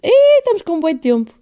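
loud high surprised cry at the clock; loud high surprise at how long the episode is; surprised at time. Real, not acted. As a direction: react loudly, caught off guard by the time. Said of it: "estava a ver quanto".

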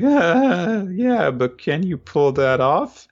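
high, slow, hesitant; nervous doubt. Retold or imitated. Gen doubtful.

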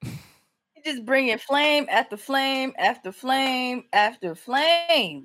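drawn out. Real, not acted. Praising host's jokes.

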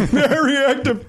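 high slow laughing echo; laughing mock echo. Real, not acted. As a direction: laugh the words out teasingly. Mocking repeat of very active.

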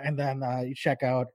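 usual speaking voice

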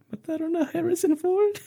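sobbing mom. Retold or imitated. Mom crying over Harrison Ford, high soft sobbing imitation. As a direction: squeak it out through mock tears.

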